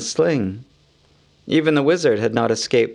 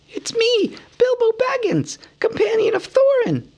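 high hobbit calling out eagerly; crying out. A narrator's reading. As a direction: call out to be found. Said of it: "he cried".

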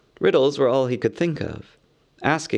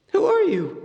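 a lightly higher, startled hobbit voice. A narrator's reading. Bilbo facing pale eyes in the dark, startled. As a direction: blurt it out in surprise. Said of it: "he said".

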